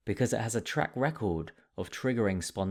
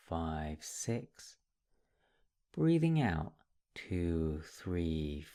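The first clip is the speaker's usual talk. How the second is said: guided meditation; 2 semitones lower, slower than usual. Real, not acted.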